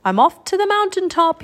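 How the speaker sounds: high, eager raven announcement; croaked call. A narrator's reading. Teddy flying by the others, croaked call. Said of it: "he croaked".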